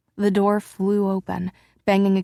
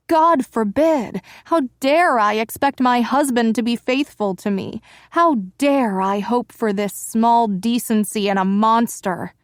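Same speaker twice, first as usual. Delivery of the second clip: high, loud bitter sarcasm; mock her own hopes; sarcastic outrage; 4 semitones higher, 5 dB louder than usual.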